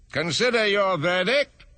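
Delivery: a hint of a fussy king. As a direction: pronounce it like a pompous little king. King addresses the jury.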